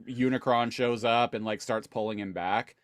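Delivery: ordinary talk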